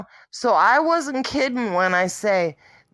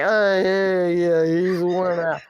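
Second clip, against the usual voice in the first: lower, lazy mock male voice; mocking the man; 5 semitones lower than usual. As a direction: drop low to mimic his tired excuse. Mocking the man's excuse.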